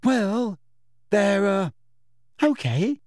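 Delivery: high, halting, uncertain answer; nervous schoolboy, pausing between words. A narrator's reading. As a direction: search anxiously for a safe answer. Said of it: "said Neville nervously".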